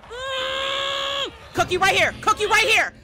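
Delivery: loud, high call to a teammate; urgent shout from the streamer; excited yelling. Real, not acted. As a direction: yell it out to the cook.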